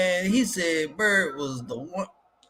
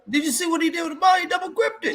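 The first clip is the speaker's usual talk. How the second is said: excited shout; 11 semitones higher, faster than usual. Real, not acted. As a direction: yell it at the replay, amazed. Excited over a dunk replay.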